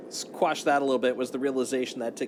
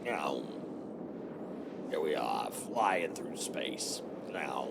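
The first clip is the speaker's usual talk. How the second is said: much lower, slow movie trailer voice; deep dramatic voice; 9 semitones lower, 7 dB quieter, much slower than usual. Pretend. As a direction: drop low and drawn out, epic narrator. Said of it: "repeated, then dropped low".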